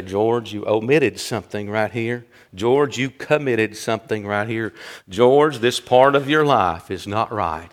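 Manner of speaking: low, softened admonishment; quiet conviction. Retold or imitated. Conviction knocking on the preacher's heart's door, quiet admonishment. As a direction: correct him quietly and seriously.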